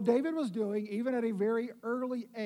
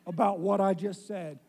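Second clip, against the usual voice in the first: ordinary talk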